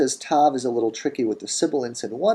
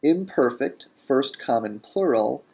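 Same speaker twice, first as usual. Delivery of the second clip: normal talking voice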